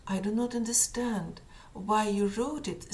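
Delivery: ordinary talk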